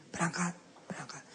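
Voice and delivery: soft unvoiced whisper; whispered urging. Preacher urging mothers off to market, whispered. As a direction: hush it like a secret push.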